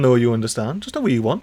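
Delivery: usual speaking voice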